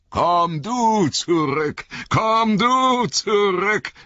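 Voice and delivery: high, slow, drawn-out taunting wraith cry; icy, mocking call. A narrator's reading. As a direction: call them back slowly, high and jeering. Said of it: "brachen in ein eisiges Hohnlachen aus". (höhnisch lockender, gedehnter Ruf)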